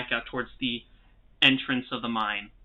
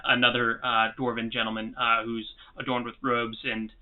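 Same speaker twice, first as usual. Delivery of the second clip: ordinary delivery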